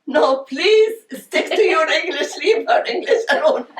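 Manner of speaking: high, laughing quote of a friend. Retold or imitated. Quoting Anwar's teasing advice, laughing high. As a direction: barely hold back the laughter. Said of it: "He said".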